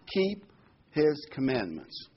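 "keep" set apart with pauses, then slow "his commandments"; weighty "keep", pause, "his commandments" drawn out; stress on KEEP. Shown as a demonstration. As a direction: read it deliberately, leaning on "keep". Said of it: "I want to emphasize the word".

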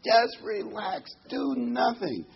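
high, slow tempter's voice; seductive devil. Acted. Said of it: "the devil, of course, wants to seduce us".

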